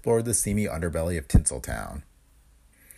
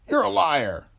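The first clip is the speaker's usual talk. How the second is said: sharp, high angry retort; angry accusation; 9 semitones higher, 4 dB louder, slower than usual. Acted. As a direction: spit it at him. Pete angrily accuses detective.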